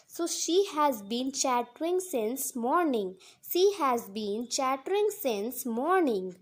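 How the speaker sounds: slow, careful teacher read of each word; slow model sentence. Shown as a demonstration. Teacher reading an example to Hindi learners, slow and clear. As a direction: say it slowly so students can repeat. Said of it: "teacher models example slowly".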